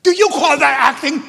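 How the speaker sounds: loud, high, scornful challenge; mock the director berating an actress; imitating the director's scolding. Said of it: "and he looks at her and says".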